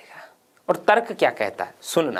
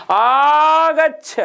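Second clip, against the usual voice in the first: higher, louder, slow drawn-out call; calling from afar; 8 semitones higher, 6 dB louder, much slower than usual. Shown as a demonstration. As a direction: call out to Krishna far off in the field. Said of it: "वो लंबी आवाज दे दी". (दूर खड़े कृष्ण को लंबी पुकार देकर बुलाना)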